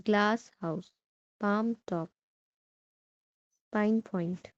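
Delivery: "glass", "palm", "pine" carry each compound; stress on first part. Shown as a demonstration. Teacher gives compound nouns, stress on "glass", "palm", "pine". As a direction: lean on "glass", "palm", "pine", lighter second parts. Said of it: "first part पे stress करेंगे".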